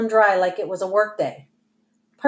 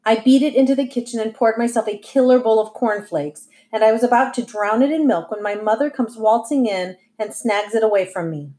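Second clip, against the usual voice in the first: ordinary delivery, nothing marked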